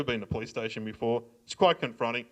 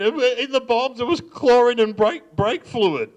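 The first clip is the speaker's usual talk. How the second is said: crying confession; 10 semitones higher, 7 dB louder, slower than usual. Retold or imitated. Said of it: "I started crying straight away".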